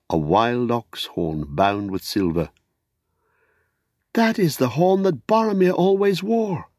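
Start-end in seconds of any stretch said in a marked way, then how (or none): 4.1-6.7 high, sudden cry of recognition. Acted. Hobbit cries out on seeing Boromir's horn, high and sharp. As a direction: blurt it out, much higher and startled. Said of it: "cried Pippin".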